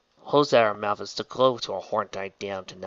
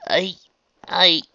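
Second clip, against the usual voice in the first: halting, higher false starts; stuttering; 9 semitones higher, 7 dB louder than usual. A narrator's reading. Raindrops stammers before fleeing Trixie, stuttering. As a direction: get stuck on the first word, louder on the retry. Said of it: "she stuttered".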